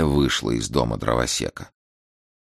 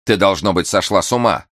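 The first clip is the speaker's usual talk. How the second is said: higher and louder, spiteful; furious boy shouting; angry exclamation; 5 semitones higher, 5 dB louder than usual. A narrator's reading. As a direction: spit it at her in anger. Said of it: "со злобой воскликнул мальчик".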